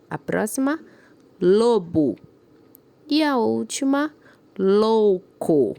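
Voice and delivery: each word read out slowly and clearly, "ou" sounded; teacher reading a word list with clear "ou"; careful word reading. Shown as a demonstration. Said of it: "de acordo com a pronúncia".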